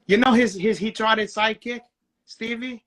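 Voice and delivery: ordinary delivery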